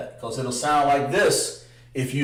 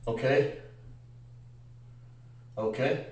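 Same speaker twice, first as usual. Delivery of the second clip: ordinary talk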